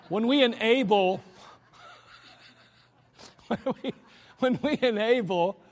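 chuckling through the words; laughing at his own word choice. Real, not acted. Self-amused stumble over a word.

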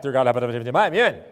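mock prayer gabbled into a blur; fast prayer. Shown as a demonstration. Preacher showing how folks pray while fasting, comically gabbled prayer. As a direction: race from "Dear God" to amen in one garbled rush. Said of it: "Or you pray fast".

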